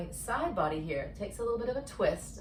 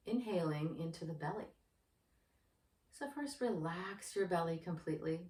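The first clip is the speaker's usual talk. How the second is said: soft, unhurried meditation guide voice; guided meditation; 2 semitones lower, 6 dB quieter than usual. Acted. Yoga teacher guides anxious viewers through breathing, in a soft meditation voice. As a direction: ease the listener into calm breathing.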